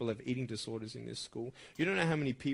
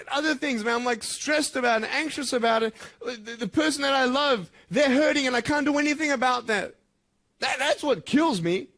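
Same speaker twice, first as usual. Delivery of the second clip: loud, high, impassioned confession; anguished outpouring; 8 semitones higher, 7 dB louder than usual. Real, not acted. Speaker helpless over a loved one hurting, loud anguish. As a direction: let the helplessness burst out at full voice.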